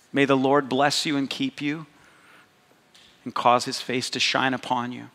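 priestly blessing voice; benediction. Real, not acted. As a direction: pronounce the blessing over them gently. Pastor gives benediction.

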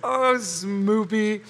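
slow, cooing pet name; drawn-out mock sweetie voice; lovey-dovey baby talk. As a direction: coo "Smoopy" like a smitten sweetheart. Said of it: "come on, Seinfeld, baby".

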